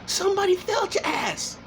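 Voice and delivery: high taunting echo of the line; mocking high repeat. Real, not acted. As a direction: throw it back in a high sneering pitch.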